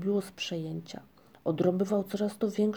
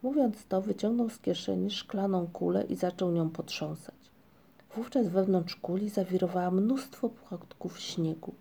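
ordinary delivery, nothing marked

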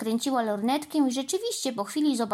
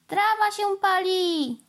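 high, drawn-out fire warning; alarmed cry; 9 semitones higher, 4 dB louder than usual. A narrator's reading. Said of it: "zawołała Mandy".

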